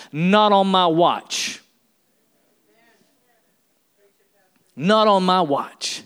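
slow, firm, every word weighed; Jesus refusing in slow, heavy resolve. Retold or imitated. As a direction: plant your feet on each word. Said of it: "righteous anger".

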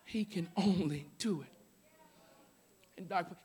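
soft and low, pulled right back; the same line gone soft; quiet repeat. Acted. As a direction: drop to a hush after the shout. Same words shouted just before.

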